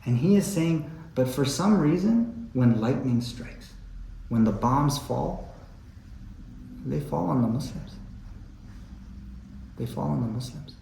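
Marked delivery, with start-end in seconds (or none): 2.5-7.9 grave lament. Acted. Lament over bombs on Muslims. 9.8-10.7 hushed echo. Acted. Repeats the line softer.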